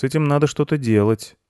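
put-on high child's voice; boy character. A narrator's reading. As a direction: urge action in a boy's pitch. Boy urges action. (высокий голос мальчика)

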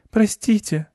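high, apologetic mouse sigh; meek little mouse apology. A narrator's reading. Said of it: "вздохнул мышь".

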